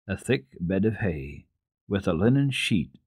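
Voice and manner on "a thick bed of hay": plain reading voice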